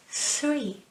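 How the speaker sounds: normal talking voice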